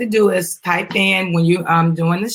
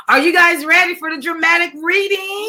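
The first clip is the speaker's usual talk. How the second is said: excited hype; 11 semitones higher than usual. Real, not acted. Mom hyping viewers before the reading, excited call. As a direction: pump up the viewers for the show.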